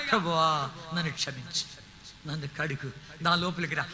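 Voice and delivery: low, soft plea for forgiveness; soft penitent prayer. Shown as a demonstration. Preacher showing the congregation a quiet prayer, soft penitent plea. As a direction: murmur it like a humble confession.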